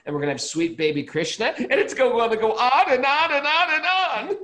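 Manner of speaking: building to a high, loud, excited peak; carried away, pitch and volume soaring. Real, not acted. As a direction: let the list build into gleeful, over-the-top excitement. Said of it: "You sound excited".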